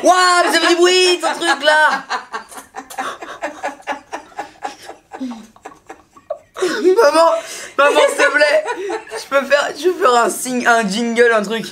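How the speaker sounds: excited, laughing through the words; laughing excitedly. Real, not acted. Delighted by the noisy toy gun.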